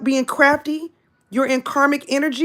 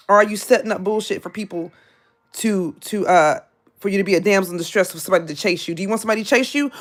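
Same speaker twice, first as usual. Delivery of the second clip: usual speaking voice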